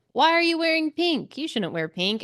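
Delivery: usual speaking voice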